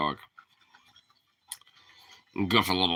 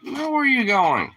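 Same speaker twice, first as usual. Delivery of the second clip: exasperated fussing; 12 semitones higher than usual. Real, not acted. Dog wanders off again.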